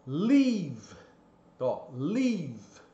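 "leave" with a long stretched ee; long open vowel. Shown as a demonstration. Teacher modelling the long vowel of "leave". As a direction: draw out the ee in "leave". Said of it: "aqui o som é".

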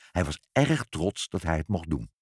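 plain reading voice

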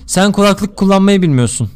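loud, emphatic joke delivery; performed repeat. Shown as a demonstration. Streamer redoing the joke on request, loud delivery. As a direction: project it clearly as a replayed gag. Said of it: "bir daha yapsana".

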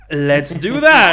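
loud, drawn-out, delighted cheer; thrilled agreement. Real, not acted. Host seizing on a chat idea, loudly excited. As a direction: jump on the idea with full enthusiasm.

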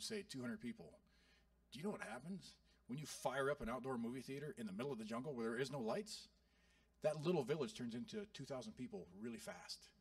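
ordinary delivery

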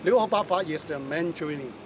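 ordinary delivery

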